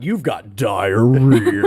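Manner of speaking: drawn-out, hammy ad announcer; mock narrator, milking the last word; commercial narrator. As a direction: stretch it out like a cheesy commercial voiceover. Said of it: "there was a narrator going".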